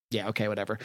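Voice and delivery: quiet, bored brush-off; mock unimpressed and done with it; dismissive mutter. [name] brushing off the priest.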